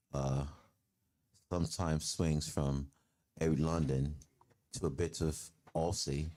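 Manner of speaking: careful, hesitant put-on London-Aussie speech; British-Australian accent. Shown as a demonstration. Explaining the dialect in its own accent, mock-proper British-Australian. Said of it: "My British accent is kind of".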